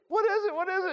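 very high, tickled questioning; catching the giggles. Acted. Preacher acting out someone catching another's laughter, giggly. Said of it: "And you start".

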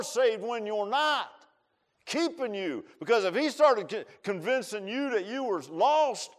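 loud, high fiery preaching; preaching at full force. Real, not acted. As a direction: drive it home from the pulpit.

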